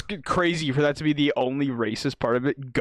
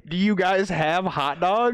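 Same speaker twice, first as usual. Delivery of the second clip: mock customer voice; 5 semitones higher, 4 dB louder, slower than usual. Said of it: "What did he ask?".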